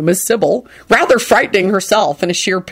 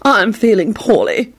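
melancholy; 3 semitones higher than usual. Acted. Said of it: "in a melancholy voice".

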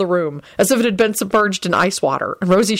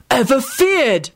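higher, slow, knowing finish; 6 semitones higher, much slower than usual. A narrator's reading. Neville finishes Scully's slip, knowingly. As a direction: finish the villain's words, drawn out.

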